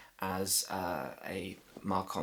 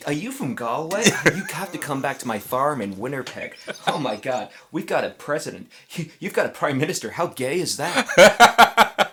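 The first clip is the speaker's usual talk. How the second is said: high, hammed-up American accent, building louder; gushing American; 6 semitones higher, 10 dB louder than usual. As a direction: gush like an excited North American tourist. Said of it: "if you're American, you're like".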